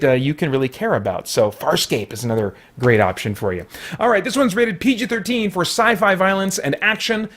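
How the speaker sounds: ordinary delivery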